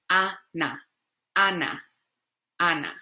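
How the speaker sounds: Ana with open Spanish A sounds; Spanish pronunciation. Shown as a demonstration. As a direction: say Ana with pure open A's. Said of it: "our A's are A in Spanish".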